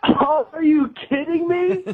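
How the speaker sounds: slow, high, incredulous groan of disbelief; stunned realization. Real, not acted. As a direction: it finally dawns on him he was pranked. Realizes he was pranked.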